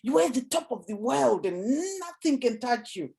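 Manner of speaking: high, soaring question to the audience; uplifting question. Shown as a demonstration. Coach demonstrating an uplifting question to the audience, excited. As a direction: lift them to the top of the world. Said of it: "make them think about it".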